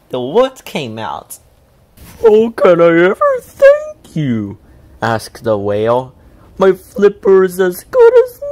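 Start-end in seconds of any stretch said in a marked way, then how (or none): 2.2-8.4 a big whale relieved of pain; booming grateful whale voice. A narrator's reading. Whale speaks.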